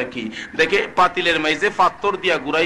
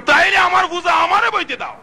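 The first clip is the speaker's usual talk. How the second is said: high acted shout; impassioned demand as the caliph; vehement insistence; 13 semitones higher, 4 dB louder than usual. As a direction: insist in a high, raised preacher's cry. Umar insists on carrying it.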